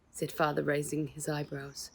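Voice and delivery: usual speaking voice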